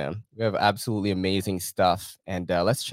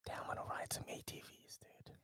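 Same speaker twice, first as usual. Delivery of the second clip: whispering; 25 dB quieter than usual. Real, not acted. Hushed wish watching video.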